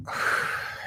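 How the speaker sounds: long audible exhale; deep breathing exhale. Shown as a demonstration. Host leading deep breathing, demonstrated exhale. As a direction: let a deep breath go in a soft rush. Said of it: "we'll do our deep breathing".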